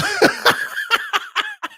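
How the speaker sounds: very high, breaking laugh; giddy laughter. Real, not acted. Host losing track of his Cash App name, giddy high laughter. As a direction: crack up mid-thought.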